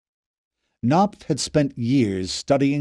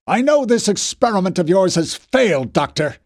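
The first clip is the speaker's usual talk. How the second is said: angry rebuke; 6 semitones higher, 4 dB louder than usual. A narrator's reading. Fisher rebuking Knopf.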